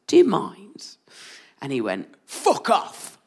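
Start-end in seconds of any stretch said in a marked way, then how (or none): 0.1-1.0 polite, higher-pitched demure objection; lightly acted demure request. Jen to the shouting man at the bar, demurely. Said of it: "very demurely turned to him and said". 2.3-3.2 higher-pitched Northern English man; curt dismissal in a put-on Northern English accent. Man at the bar to Jen, in a Northern English accent. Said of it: "I don't know why I've made him Northern".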